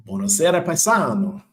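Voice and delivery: high, hammed-up Italian greeting; Italian accent greeting. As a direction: throw out a playful Italian-accented hello. Greets chatter as Italian paisano.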